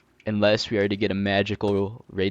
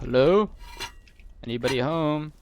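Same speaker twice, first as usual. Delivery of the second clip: calling down a hallway; 8 semitones higher, 7 dB louder than usual. Acted. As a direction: call out to see if anyone's home. Roaming an enemy base, calling out.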